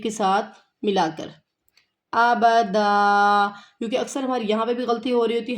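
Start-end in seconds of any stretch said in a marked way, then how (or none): 2.1-3.5 ابدا drawn out slowly with the alif held; slow correct reading. Shown as a demonstration. Teacher shows students the unhurried reading, slow. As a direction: stretch ابدا calmly and long. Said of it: "ہمیں تسلی کے ساتھ پڑھنا ہے".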